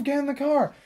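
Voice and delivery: insist, losing patience; impatient order. Retold or imitated. Driver repeating order to staring kid, exasperated. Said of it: "she's like".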